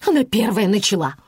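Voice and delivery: testy, defensive outburst; angry. A narrator's reading. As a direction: blame the other one crossly. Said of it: "разозлилась его жена".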